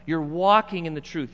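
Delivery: normal talking voice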